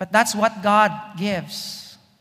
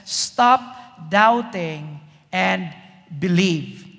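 stretched slow, word by word; slow command; 3 semitones lower, much slower than usual. Acted. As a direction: draw out the command. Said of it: "Jesus says".

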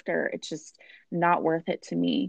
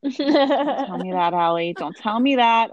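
higher, louder playful objection; mock protest; 5 semitones higher, 4 dB louder than usual. Host to guest Allie over her answer, mock protest. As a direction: protest in mock dismay, pitched up.

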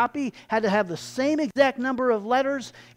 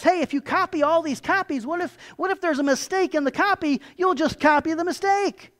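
urgent, higher-pitched objection; challenging objection; 7 semitones higher than usual. Acted. New monk questioning the head monk, challenging and urgent. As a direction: press the point with rising insistence.

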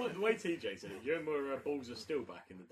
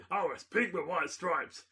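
smug contestant; 8 semitones higher, 10 dB louder, slower than usual. Retold or imitated. Contestant answers confidently.

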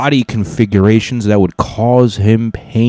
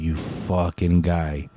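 low, slow mock mumble, much softer; 3 semitones lower, 10 dB quieter, slower than usual. Rider cursing the ride guard to himself, quiet slow mumble. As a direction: mumble a curse under your breath. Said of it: "in my mumble".

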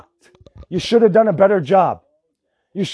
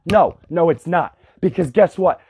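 usual speaking voice

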